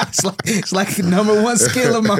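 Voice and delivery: laughing while joking. Real, not acted. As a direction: giggle through the brag. Laughing about his accent.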